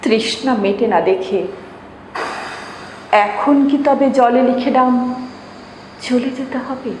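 usual speaking voice

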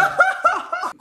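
squealing high-pitched laugh. Real, not acted. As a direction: burst of giggles. Son laughs while stuck.

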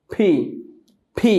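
ফি with a puff of air after closed lips; aspirated P. Shown as a demonstration. Teacher showing the aspirated P to students. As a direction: pop ফি with a breath burst. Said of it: "দুই ঠোঁট বন্ধ করে একটা বাতাস বের হবে".

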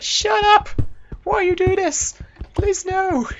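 loud, very high squealed pleading; imitating annoyed schoolmates. Schoolmates begging him to stop singing at school, mock protest. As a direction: mock-desperate falsetto outcry. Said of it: "everyone's like".

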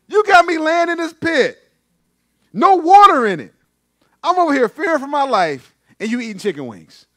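high, loud, hammed-up outrage; indignant protest. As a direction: cry out in mock grievance from down in a pit. Acted Joseph protesting from the pit.

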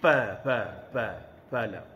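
fala said with a p, pala; the old way, saying fala like pala; teacher mimicking older learners' pa. Shown as a demonstration. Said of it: "Satu sebut".

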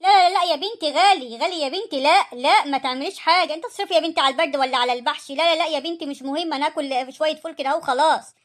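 a mother refusing her daughter's money; loud refusal. Acted. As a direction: wave off the offer loudly. Mother refusing costly help.

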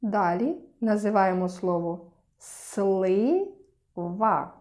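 ordinary talk, nothing marked

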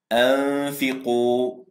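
أنفقوا with the نون hidden, a long nasal hum before the فاء; slow, drawn-out أنفقوا, nun held in the nose; nasal ikhfa demo. Shown as a demonstration. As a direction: recite أنفقوا with a long ghunna. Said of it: "سوف يتبين لنا النطق السليم".

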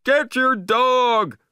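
loud, high, drawn-out yell; angry shout. A narrator's reading. Beachgoer yelling at dog's owner, angry shout. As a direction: yell an outraged order across the beach. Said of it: "somebody shouted angrily".

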